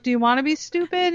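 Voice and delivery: quick, high-pitched challenging question; retold challenge. Mom questions daughter in the car, retold challenge. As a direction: press the kid with a mock-incredulous question. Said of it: "And I'm like".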